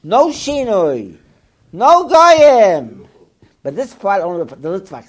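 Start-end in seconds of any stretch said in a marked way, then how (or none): 0.0-3.1 rising to a loud, high proclamation; emphatic quoted ruling. Retold or imitated. Rabbi quoting the poskim to his class, loud and emphatic. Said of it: "That's the way they say".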